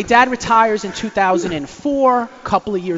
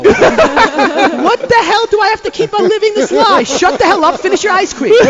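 much higher, louder mock dad; dad's gruff retort; 11 semitones higher, 8 dB louder than usual. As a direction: wave the son off. Dad snapping at son.

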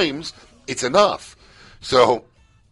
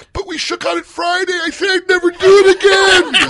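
high, wailing protest swelling louder; mock distraught plea; 8 dB louder than usual. Host imitating woman pleading about Friday's truce, distraught. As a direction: whine it up into a loud cry.

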